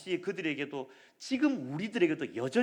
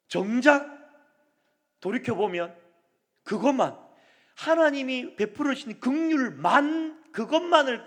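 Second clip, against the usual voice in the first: raised, louder pulpit voice; preacher scolding the congregation, louder and higher; fervent preaching; 7 semitones higher, 9 dB louder than usual. Acted. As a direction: press the rebuke home to the congregation. Preaching at full force.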